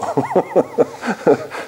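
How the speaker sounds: a quick high, soft laugh; short laugh. Real, not acted. Preacher laughing softly at Rustum's bribe, short laugh.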